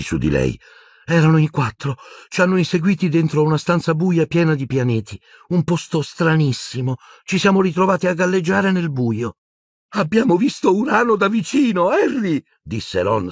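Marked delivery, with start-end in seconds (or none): 1.1-9.4 put-on high-pitched girl character voice; Luna's anxious account. A narrator's reading. Luna reports the chase to Harry, high and urgent. 10.0-12.4 much higher-pitched, giddy giggly voice; silly, excited tittering delivery; giggling. A narrator's reading. Dazed Ron tells Harry about Uranus, giggling. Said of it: "sempre ridacchiando".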